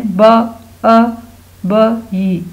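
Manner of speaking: ब, अ, ब, ई as isolated sounds; sounding out spelling. Shown as a demonstration. Teacher dictating baby's spelling to pupils, sounding out. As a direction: say ब, अ, ब, ई separately, leaving gaps. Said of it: "अब आप इसकी spellings लिखें".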